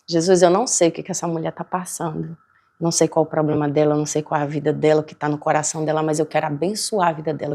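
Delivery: quiet prayer. Retold or imitated. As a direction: pray gently, blessing her life.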